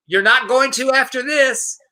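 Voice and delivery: high-pitched imitated taunt; taunting protester. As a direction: needle them like a heckling protester. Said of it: "And he goes".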